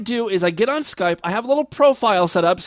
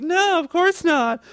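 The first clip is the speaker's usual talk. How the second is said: high, slow fake girl voice; 10 semitones higher than usual. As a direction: play a coy teen girl, slowly. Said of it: "And I'm like".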